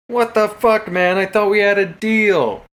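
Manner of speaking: high, loud indignant protest; betrayed outrage. Acted. Rogue protesting the speaker's betrayal, high and loud. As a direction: feel double-crossed.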